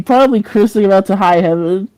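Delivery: ordinary talk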